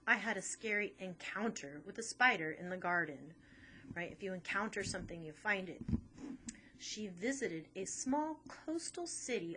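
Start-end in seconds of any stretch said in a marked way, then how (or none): none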